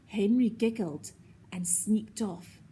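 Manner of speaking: plain reading voice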